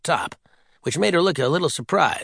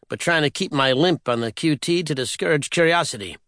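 plain reading voice

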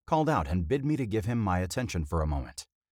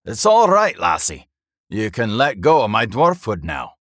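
loud, higher-pitched dwarf banter; gruff dwarf; 4 semitones higher, 10 dB louder than usual. A narrator's reading. Dwarf teasing the lass holding him, hearty and loud. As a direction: tease her in a hearty dwarf voice.

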